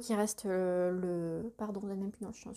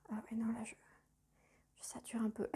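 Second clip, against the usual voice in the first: whispering; 10 dB quieter, faster than usual. Real, not acted. Weary aside about too much brown.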